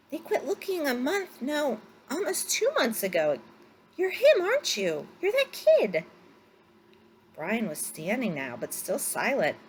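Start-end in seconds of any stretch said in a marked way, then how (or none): 0.1-3.4 catching up to the realization; excited. A narrator's reading. Pilot recalls the search. 4.0-6.0 much higher and louder, thrilled; almost shouting with amazement; excited exclamation. A narrator's reading. Pilot repeats, now certain.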